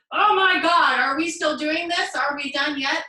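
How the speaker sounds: hammed-up impatient moaning; mock whiny complaint. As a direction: whine like the pose will never end. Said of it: "rather than going".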